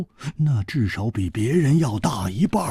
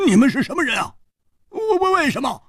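high, agitated stammered question; loud questioning; 14 semitones higher, faster than usual. A narrator's reading. Hu Tiehua confronting two strange men, asking loudly. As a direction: blurt it out high and stuttering. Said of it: "他忍不住大声地问".

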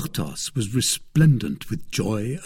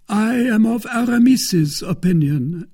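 loud, high-pitched character voice; Porthos; 12 semitones higher, 6 dB louder than usual. A narrator's reading. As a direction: pitch it well up and project heartily.

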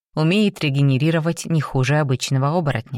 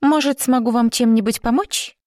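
cartoon princess; 8 semitones higher than usual. A narrator's reading. Flirty nurse offers help.

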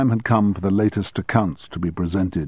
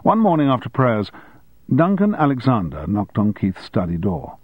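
ordinary delivery, nothing marked

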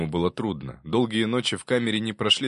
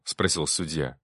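usual speaking voice

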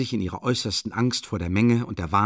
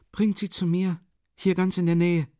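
put-on higher, imploring young woman; pleading; 9 semitones higher than usual. A narrator's reading. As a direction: beg them to bring her. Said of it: "flehte Sonja".

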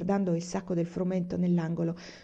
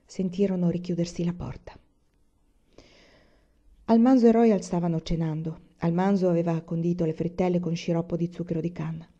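plain reading voice

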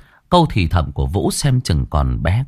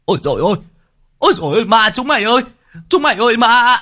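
high, fast frantic shrieking; panicked scream; 13 semitones higher, 3 dB louder, much faster than usual. Acted. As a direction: scream it out in pure terror. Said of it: "Một kẻ hét toáng lên".

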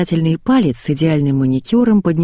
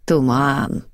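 put-on unpleasant old woman voice; 3 semitones lower than usual. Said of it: "противным голосом произнесла старуха".

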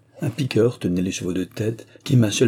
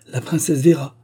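VÉRA higher and louder; insistent repetition; 6 semitones higher, 5 dB louder than usual. A narrator's reading. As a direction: insist on Véra, pitched up and strong. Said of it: "répéta Serge". (répéter en appuyant sur Véra, plus haut)

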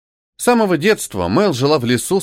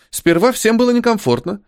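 high, lightly defensive excuse; defensive denial; 10 semitones higher than usual. A narrator's reading. Blonde answering her friend's accusation, defensive. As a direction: brush off the accusation, pitched up. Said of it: "открестилась девушка".

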